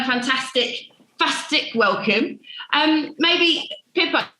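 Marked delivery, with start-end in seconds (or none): none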